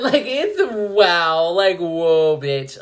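laughing, awed and loud; overwhelmed delight; gushing with delight. Real, not acted. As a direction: rave about it breathlessly. Gushing over the vibrator.

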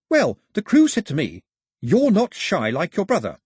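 high, animated explainer; lively Pilou. Acted. Pilou translates the song for Mao, high and eager. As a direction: explain it eagerly, pitched well up.

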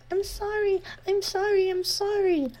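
put-on high pained girl sorry; pained apologies. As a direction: repeat it like a broken record. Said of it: "like a broken record".

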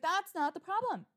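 frustrated retort to the dealer; fed-up retort. Retold or imitated. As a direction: lose your patience on the phone. Retold argument, losing patience.

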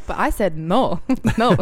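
mock vampire doing a pop lyric; lightly hammed-up Transylvanian accent. Host finishing the lyric as vampire singer, playful accent. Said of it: "playing Nadja playing [name]".

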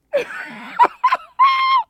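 shrieking high cackle of real laughter; loud cackle. Real, not acted. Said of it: "listening to my cackle".

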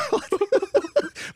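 amused burst of laughter; laughing. Real, not acted. Laughs at the story.

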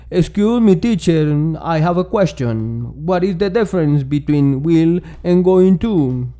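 put-on student's Spanish-accented English, read slowly; Colombian-accented English. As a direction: sound like a Tolima student asking in class. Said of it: "pide la palabra y dice". (inglés con acento tolimense, lento)

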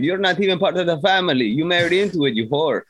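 high-pitched imitated scolding; mock put-down. Retelling the family snubbing an in-law, high mock put-down. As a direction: put her in her place, exaggeratedly. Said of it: "put her in her place".

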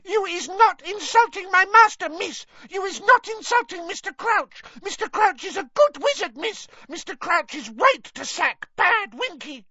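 piercing, very high, loud elf screech. A narrator's reading. Winky defends her master to Hermione, screeching. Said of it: "and screeched".

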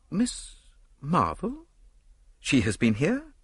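high, halting, questioning surprise; startled, rising questions; surprised echo. A narrator's reading. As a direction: echo the name in disbelief. Startled visitor echoes the name.

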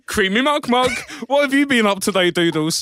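put-on high, loud kids' TV host; hammed-up presenter voice. Presenters greet the cat, loud and bouncy. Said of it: "Dick and Dom go".

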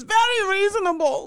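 loud, emphatic repeat; shouting. Acted. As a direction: shout the words at her. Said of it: "don't need to shout".

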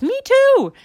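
very high, loud squeak; alarmed child. Acted. Brother realizing he forgot hamster, alarmed. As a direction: cry it out as the little brother.

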